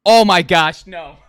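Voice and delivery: laughing in dismay. Real, not acted. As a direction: laugh through the groan. Bad answer, laughing.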